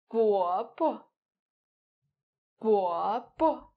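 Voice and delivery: "bo bo" with a stressed first syllable and a short, light second; uncle, "bo bo" with light second syllable; neutral tone bobo. Shown as a demonstration. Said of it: "read them together one more time".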